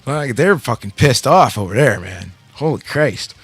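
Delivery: ordinary delivery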